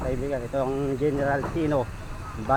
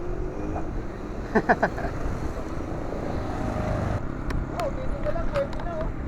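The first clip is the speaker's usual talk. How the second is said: airy high giggle; breathy laugh; 4 dB quieter than usual. Real, not acted. Laughing at fried chicken.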